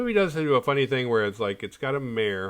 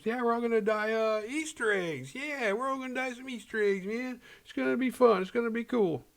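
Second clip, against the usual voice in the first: much higher, carefree mock voice; breezy mayor; 12 semitones higher than usual. Mayor reassuring the kids, much higher carefree voice. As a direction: brush it off like a goofy politician. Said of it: "He goes".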